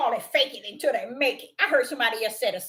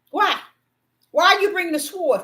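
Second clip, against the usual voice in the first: usual speaking voice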